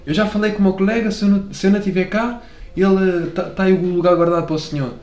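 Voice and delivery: amateur Algarve-Alentejo accent imitation; attempted Alentejo accent. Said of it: "mas eu não sei imitar".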